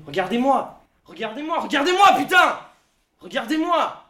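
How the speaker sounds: appel de plus en plus fort et aigu, jusqu'à hurler; cri qui monte. Acted. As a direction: crie Regardez-moi toujours plus haut, comme un désespoir. Supplie qu'on le regarde. (il exige qu'on le regarde, crescendo jusqu'au hurlement)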